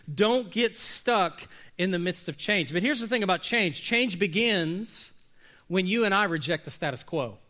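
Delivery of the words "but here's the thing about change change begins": usual speaking voice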